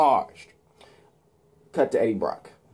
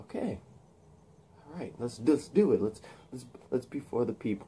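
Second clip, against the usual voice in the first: meek mumble; 11 dB quieter than usual. Acted. Pilot's meek reply.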